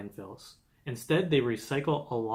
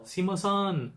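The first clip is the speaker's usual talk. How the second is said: higher-pitched call across a restaurant; mock yell; 7 semitones higher, slower than usual. Summoning staff in a restaurant, mild mock yell. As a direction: call out for the waiter, held back in volume. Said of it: "one would yell".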